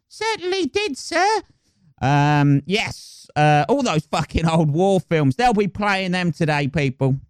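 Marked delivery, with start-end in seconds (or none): 0.1-1.4 squeaky falsetto yes-man; much higher, eager cockney helper; plucky cockney sidekick. Acted. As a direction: sidekick agreeing with the general. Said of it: "the plucky fucking cockney sidekick".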